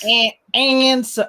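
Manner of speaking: high, slow put-on voice; impression attempt. Practicing a hard comedian's voice, high slow mimicry. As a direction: try out the voice, high and drawn out. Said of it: "it's not deep and it's not too high".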